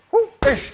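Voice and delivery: sharp high whoop on the shift; whoop sound effect. Shown as a demonstration. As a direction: vocalize the slip. Coach vocalizing the punch rhythm.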